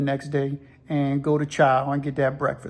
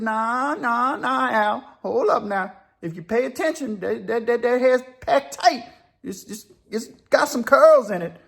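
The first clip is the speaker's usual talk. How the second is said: higher-pitched, animated objection; insistent prison buddy; 7 semitones higher than usual. Retold or imitated. Jim argues with Al about Italian hair, insistent. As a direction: argue the point in a raised pitch.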